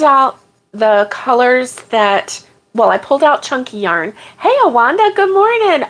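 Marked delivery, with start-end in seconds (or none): 4.4-5.9 bright greeting. Real, not acted. Greeting a live chat viewer.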